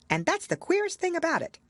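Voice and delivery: high, bright rabbit voice. A narrator's reading. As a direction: squeak it like a nervous rabbit.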